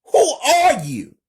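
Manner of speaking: high, loud, frightened shout; alarmed high-pitched demand; scared yell. A narrator's reading. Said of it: "and yells".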